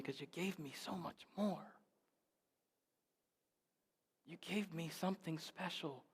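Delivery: quiet grateful prayer. Acted. Thankful prayer to God.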